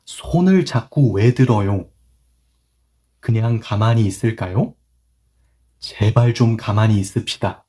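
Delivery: moderately fast read-through. Shown as a demonstration. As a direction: read the lines at a brisker clip. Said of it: "With more 속도, speed".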